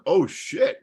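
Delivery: high, loud startled oh shit; shocked. Retold or imitated. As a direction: blurt it out caught off guard. Shocked reaction in retold scene.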